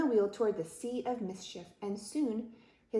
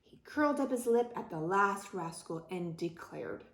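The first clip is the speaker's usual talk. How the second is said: usual speaking voice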